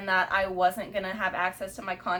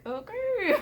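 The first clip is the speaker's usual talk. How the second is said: high, flat mock okay; a falsetto shrug of an okay; lightly voiced nurse; unbothered staff; 11 semitones higher than usual. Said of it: "they're just like".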